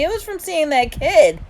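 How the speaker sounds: put-on high, loud child's retelling; excited insistence. A narrator's reading. Little brother tells mom of his fright, excited.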